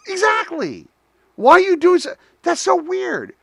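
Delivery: high, incredulous retold outburst; exasperated disbelief. Retold challenge to the trainer.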